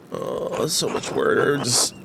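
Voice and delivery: weary complaint. Real, not acted. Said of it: "I'm just gonna keep typing".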